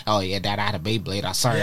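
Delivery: put-on low, soft kid confessing to his dad; a hint of a timid son owning up; lightly voiced apologetic child. Imagined son owning up to his dad, low and soft. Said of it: "my child ever coming home saying".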